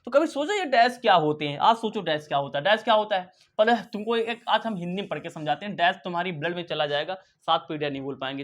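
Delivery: usual speaking voice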